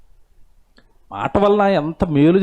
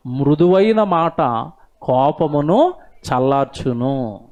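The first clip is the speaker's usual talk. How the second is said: scripture recitation; 3 semitones lower, much slower than usual. Acted. Quoting a Bible verse aloud.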